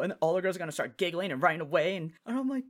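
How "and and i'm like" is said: ordinary talk, nothing marked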